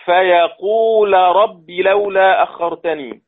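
فيقول رب slow, the L of فيقول kept clear before رب; clear l, recited. Shown as a demonstration. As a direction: slowly recite فيقول رب keeping the L distinct from the R. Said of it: "لام مفتوحة قبلها واو ... إظهار".

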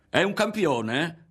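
high, slow, loud, rising at the end; jeering, rising disbelief; mocking question. A narrator's reading. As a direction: draw it out in mock wonder. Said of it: "E rideva".

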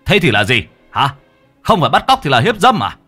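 loud, aggressive challenge; belligerent. A narrator's reading. Husband accusing the stranger, belligerent demand. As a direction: fire questions at him, rising on the last. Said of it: "Người kia hùng hổ".

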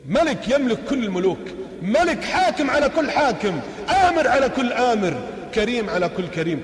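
high, loud sermon cadence; fiery preaching. Acted. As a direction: preach it out to the crowd. Preaches at full force.

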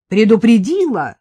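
mocking, loud sarcastic query; biting sarcasm; sarcastic question. A narrator's reading. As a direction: throw her word back with scorn. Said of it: "С непередаваемым сарказмом".

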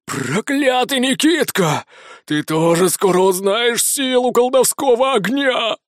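high, slow hissing through clenched teeth; through gritted teeth. A narrator's reading. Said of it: "злобно прошипел сквозь стиснутые зубы".

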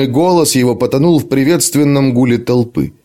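plain reading voice

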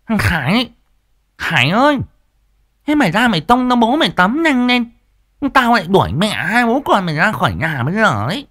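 put-on high scolding old wife; nagging wife Liên. Wife calling the son.

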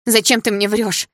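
high, loud, drawn-out anguished question; choked with pain. A narrator's reading. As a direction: demand it as if the pain takes your breath. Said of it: "задыхаясь от боли".

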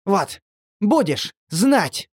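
slow, panting angry huff; out of breath. A narrator's reading. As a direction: gasp it out, out of breath. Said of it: "запыхавшийся делец".

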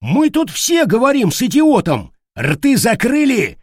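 loud, high-pitched furious order; angry shouting. Acted. Gang quarrel over lost money, angry shouting.

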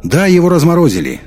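convincing oratory. A narrator's reading. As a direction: address a whole hall with conviction. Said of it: "голос его звучал так убедительно".

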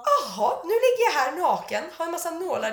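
incredulous stocktaking. Retold or imitated. Patient lying naked full of needles, incredulous. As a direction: take stock in rising disbelief.

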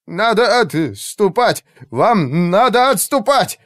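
high, slow, stretched-out urging; panicked plea. A narrator's reading. Frantic mage urges commander to retreat, panicked cry. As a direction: beg them desperately to retreat.

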